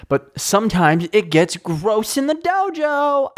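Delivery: high, drawn-out silly proclamation; high mock voice. Podcaster declaring a silly dojo rule, high mock voice. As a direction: sing it out slowly, high and silly. Mock announcement of a running catchphrase.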